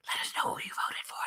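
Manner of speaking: a quick unvoiced whisper aside; hushed, fast, conspiratorial whisper. Real, not acted. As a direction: lean in and whisper it to the audience. Host aside to audience.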